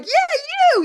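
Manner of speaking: sharp high jab; "Yeah, you" flung out high and loud; mocking challenge. Imitates woman singling her out.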